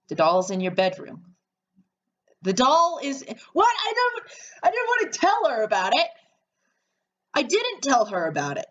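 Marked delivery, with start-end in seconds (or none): 2.4-4.2 high, sudden confused pitch; startled confusion. Real, not acted. Player startled that the doll is already in the bedroom, high and confused. 4.6-6.1 high, fast, rattled objection; blurting it out in disbelief; alarmed protest. Real, not acted. Player protesting that the doll was meant as a surprise, high, fast and rattled.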